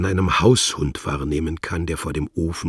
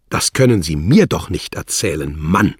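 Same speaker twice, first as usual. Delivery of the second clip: louder, blurted disbelief; honestly astonished; 8 dB louder than usual. Acted. Wladimir hears that the anarchist is married, astonished. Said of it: "stieß Herr Wladimir ehrlich erstaunt vor".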